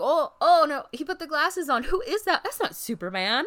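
high, loud mock alarm; mock shock. Bystanders fooled by Superman's glasses, hammed-up loud surprise.

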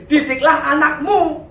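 loud, high, drawn-out proclamation; emphatic verse quote. Acted. Preacher telling men what scripture really demands, loud proclamation. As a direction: proclaim it like a command.

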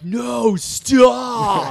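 shouted fake American accent; over-exaggerated American accent. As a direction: yell it in a hammy American accent. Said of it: "The way he performs it".

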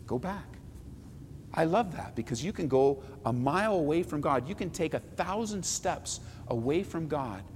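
soft, gentle invitation to return; quiet pastoral appeal. Real, not acted. Pastor gently urging believers to return, soft and quiet. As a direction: draw them back softly.

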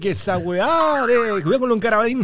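high-pitched caricature Chilean; Chilean accent. Pretend. Comedian asked to imitate a Chilean, high Chilean accent. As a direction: put on an exaggerated Chilean voice. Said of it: "Imítame un chileno".

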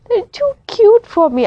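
high, loud squeal of delight. Real, not acted. Fangirling about the drama leads, a high squeal. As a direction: squeal it out, overwhelmed by cuteness.

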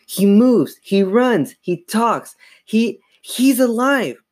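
high, mounting desperate cries; frantic insistence. A narrator's reading. Girl shaking the dummy before her parents, frantic. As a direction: hammer out each claim frantically.

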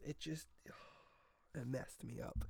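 breathy whispered sigh. Real, not acted. Vlogger recalling the juice video, whispered sigh. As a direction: exhale it almost without voice.

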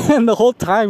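real chuckle spilling into the words; laughing. Real, not acted. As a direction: start on a laugh, pitch up. Laughs while resuming the story.

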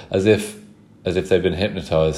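usual speaking voice

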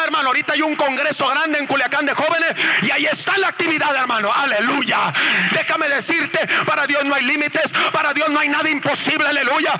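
high, shouted, driving pulpit proclamation; full-force preaching. Real, not acted. Preacher proclaiming miracles and calling for applause, full-force preaching. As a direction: drive the congregation on in a high shout.

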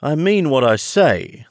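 high, loud, huffy retort; offended. Acted. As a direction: snap back as if affronted. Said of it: "replied in an offended tone".

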